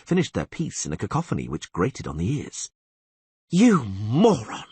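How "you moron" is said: slow, loud furious insult; angry yell. A narrator's reading. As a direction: spit the insult out. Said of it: "Yennefer yelled".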